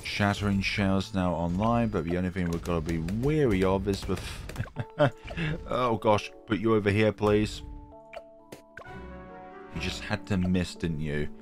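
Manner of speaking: ordinary talk, nothing marked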